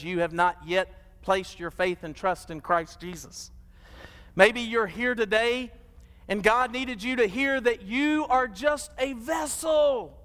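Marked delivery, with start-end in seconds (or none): 4.3-10.2 much higher, slow, shouted plea; impassioned altar call. Acted. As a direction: call them forward with everything you have. Altar call at full force.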